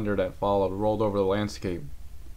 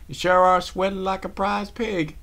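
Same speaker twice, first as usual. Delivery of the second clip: higher, louder, slow teasing; teasing laugh; 11 semitones higher, 6 dB louder than usual. Acted. Applejack teases Rarity for sweating. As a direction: rib her like an old friend. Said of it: "Applejack laughed".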